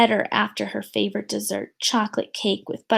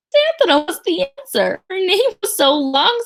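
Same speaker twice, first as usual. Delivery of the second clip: higher, louder tattling; bratty kid; 7 semitones higher, 5 dB louder than usual. Acted. Jo tattling to the teacher, bratty. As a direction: tell on her gleefully.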